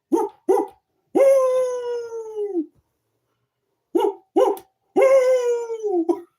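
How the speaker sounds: high, loud wolf howling at the moon; long high woo-woo howl. Pretend. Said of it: "Howl at the moon".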